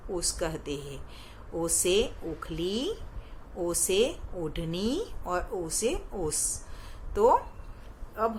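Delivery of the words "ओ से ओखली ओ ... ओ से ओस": slow drill. Shown as a demonstration. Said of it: "teacher drills vowel words slowly".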